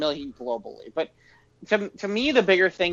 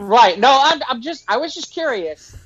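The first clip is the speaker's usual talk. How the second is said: emphatic; 6 semitones higher, 7 dB louder than usual. Real, not acted. Defensive reaction to teasing.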